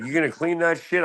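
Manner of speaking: a scandalized nag; imitating staff. Hospital staff scolding about the bed, high mock voice.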